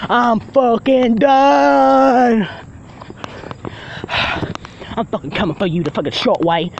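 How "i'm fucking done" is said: drawn out. Real, not acted. Gamer announcing he quits, dragged out very slowly. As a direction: drag out every word of "I'm fucking done", leaning on "done". Repeats quit line twice.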